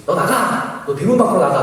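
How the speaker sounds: high-pitched imitated command to leave; angry ordering out. Missionary expelling the intruder from the gate, mock anger. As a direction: order him out, voice lifted.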